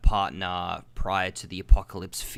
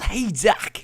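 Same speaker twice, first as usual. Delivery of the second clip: high, loud movie catchphrase; excited teen hero impression; Marty McFly; 12 semitones higher, 8 dB louder than usual. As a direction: call out in a high mock-film voice. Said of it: "Just the old".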